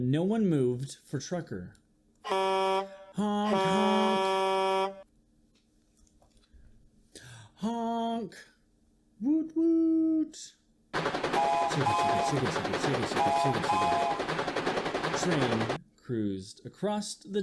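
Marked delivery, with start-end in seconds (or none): 2.2-8.3 horn honks. Acted. Reading a picture book aloud. 9.2-10.4 train whistle. Acted. Reading a picture book aloud. 10.9-15.2 train chugging. Acted. Reading a picture book aloud.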